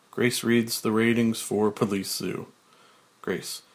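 normal talking voice

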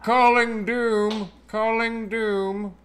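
slow, high robotic calling; toy robot voice. Pretend. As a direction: chant it like a robot dialing out. Robot calling Doom on comms.

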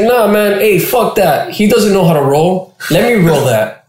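brash joint roller. Retold or imitated. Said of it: "he's like".

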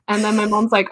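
ordinary talk, nothing marked

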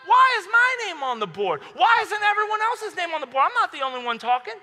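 high, loud outraged protest; losing it. Retold or imitated. Student losing it at the teacher over his name on the board, indignant shouting. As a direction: act out a student blowing up in disbelief. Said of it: "I lose it".

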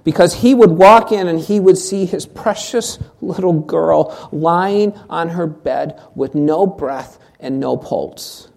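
ordinary talk

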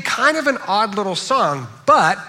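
ordinary talk, nothing marked

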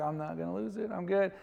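retold composed self-assurance; contained, trying to convince yourself; holding it together. Pastor telling himself he will not cry, holding it together.

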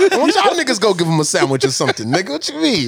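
high-pitched comic indignation with laughter; mock scolding. Podcaster turning charity back on friends, mock scolding.

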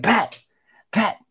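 high, bubbly, over-the-top delight; imitating a giddy celebrity contestant; excited gushing. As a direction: gush at Pat like a thrilled fan. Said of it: "she's going nuts".

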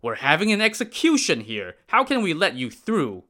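high, a touch indignant refusal; refusing soldiers. Acted. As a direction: scoff at the request.

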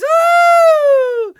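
high, loud hammed-up whooping and boasting; sarcastic over-the-top cheerleader; mock rally cheering. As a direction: yell it like a sneering parody of the crowd.

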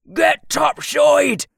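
high, slow shouted command; loud gung-ho soldier. Acted. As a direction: shout them up onto the deck. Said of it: "the soldier yelled".

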